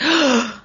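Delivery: quick little gasp of relief; gasp, then gush; relieved gasp. Retold or imitated. Relief after Steamboat forgives.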